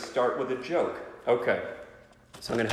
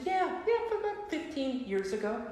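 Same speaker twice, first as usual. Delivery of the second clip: sheepish high voice; 4 dB quieter than usual. Retold or imitated. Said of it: "And I said".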